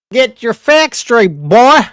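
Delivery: put-on high, loud Southern drawl telling off a boy; a hammed-up Southern put-down; mock Southern scold. Retort to chat about accents.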